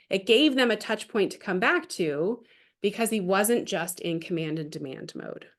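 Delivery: ordinary talk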